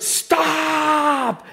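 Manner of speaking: high, long-held stop; reenacted scream. Preacher reenacting screaming at a boat, high sustained yell. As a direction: cry it out high and hold it. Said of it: "literally screaming my lungs out".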